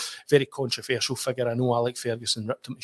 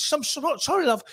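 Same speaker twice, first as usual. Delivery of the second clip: much higher, puzzled English woman; polite operator not catching it; English copy taker. Retold or imitated. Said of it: "She says".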